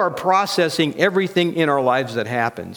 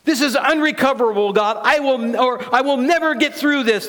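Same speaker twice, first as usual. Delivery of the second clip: high-pitched, urgent despair; despairing worldly voice; 10 semitones higher, 4 dB louder than usual. Acted. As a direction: cry out to God that all is lost, pitched up. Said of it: "That's what my worldly voice is telling me".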